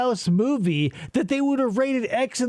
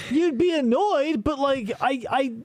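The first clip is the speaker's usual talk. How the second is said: ordinary talk, nothing marked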